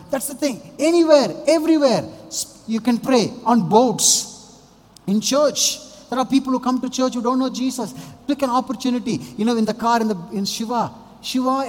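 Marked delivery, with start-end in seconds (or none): 0.1-10.0 preaching at full force. Acted. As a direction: lift the congregation with a ringing call. Preacher building to a peak.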